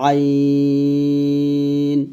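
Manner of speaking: আইন drawn out with light pressure from mid-throat; Arabic letter demo. Shown as a demonstration. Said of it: "আমার সাথে আপনারা আবারও পড়েন". (গলার মাঝখান থেকে চাপ দিয়ে আইন)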